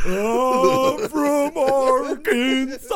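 high, loud, long drawn-out holler; mock spirit cry. Co-host playing along with the monster bit, hollering. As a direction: stretch every word into a high shout.

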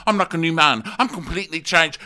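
high, loud manic bragging; hammed-up boasting. [name] bragging to Lisa, high and loud. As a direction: show off like a man gone giddy.

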